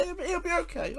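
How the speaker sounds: very high, stumbling insistence; squeaky-high retold reassurance. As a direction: reassure her, voice pushed right up high. Reassuring the alarmed wife.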